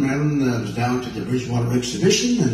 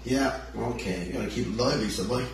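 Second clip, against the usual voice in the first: normal talking voice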